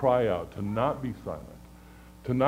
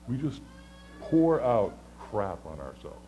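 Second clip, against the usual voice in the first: ordinary talk, nothing marked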